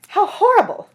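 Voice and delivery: high, loud, appalled outcry; horrified cry. Acted. Fantine cries out in horror at the dentist's offer, high and loud. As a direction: recoil in horror at the thought. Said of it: "cried Fantine".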